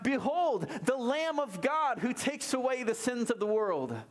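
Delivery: crying out. Retold or imitated. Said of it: "he cries out".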